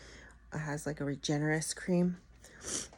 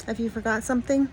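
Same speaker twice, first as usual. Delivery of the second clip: sassy cat voice; 6 semitones higher, 4 dB louder than usual. Retold or imitated. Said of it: "She's looking at me like".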